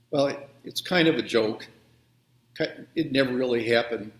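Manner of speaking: usual speaking voice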